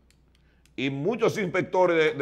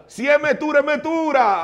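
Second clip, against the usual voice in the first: higher and louder, pushing the point; insistent; 10 semitones higher, 6 dB louder than usual. Real, not acted. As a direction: hammer it home with heat. Arguing Christmas dinner must be lavish. (insistente, más agudo y fuerte)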